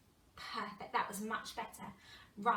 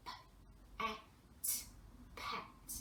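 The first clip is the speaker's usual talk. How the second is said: unvoiced whispered sounding-out; 13 dB quieter than usual. Shown as a demonstration. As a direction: whisper each letter. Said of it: "quiet children's turn model".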